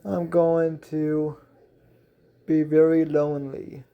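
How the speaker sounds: mildly forlorn sigh in a story voice. A narrator's reading. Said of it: "sighs as she says".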